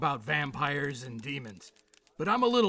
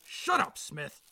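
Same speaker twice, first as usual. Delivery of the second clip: angry; slower than usual. Acted. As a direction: cut him off hard. Chief snaps at officer.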